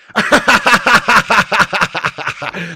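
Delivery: loud, high cackle at the rivals; long, loud staccato laugh; mocking cackle. Real, not acted. Laughing at Scousers over their league, mocking cackle.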